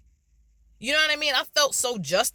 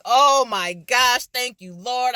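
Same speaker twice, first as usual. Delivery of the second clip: relieved excitement; 7 semitones higher, 7 dB louder, much slower than usual. Real, not acted. Relief at finding videos.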